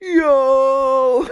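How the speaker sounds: long, very high mock holler; imitated yell. Jamaican buddy yelling in the theater, imitated high holler. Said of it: "he was just yelling".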